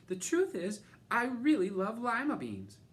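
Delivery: high, loud burst of honesty; blurted confession. A narrator's reading. Camilla finally admitting she loves lima beans, loud and high. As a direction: let it all out at once.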